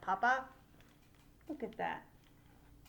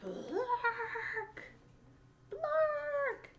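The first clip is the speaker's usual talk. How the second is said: bird squawks; 14 semitones higher than usual. Acted. Little Bird squawking at Fish and Ladybug. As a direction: squawk twice, second sharper.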